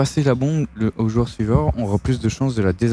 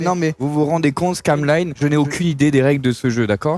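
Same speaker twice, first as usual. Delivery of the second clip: streamer hype intro; 5 semitones higher than usual. Acted.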